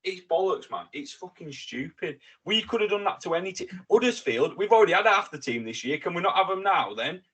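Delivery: exasperated protest. Real, not acted. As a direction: argue your grievance in real exasperation. Protesting the loan deal, exasperated.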